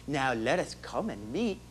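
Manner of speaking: ordinary talk, nothing marked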